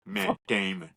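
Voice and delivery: put-on puppet accent; Matt Damon puppet. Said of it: "We're gonna roll with it".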